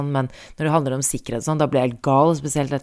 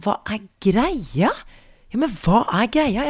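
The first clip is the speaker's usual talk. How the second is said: exasperated; 6 semitones higher, slower than usual. Real, not acted. Exasperated question.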